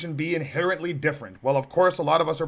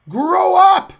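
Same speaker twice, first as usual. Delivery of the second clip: full shout, much higher and louder; shouted grow up; 12 semitones higher, 13 dB louder than usual. Real, not acted. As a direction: yell it at them with force. Scolding the professors.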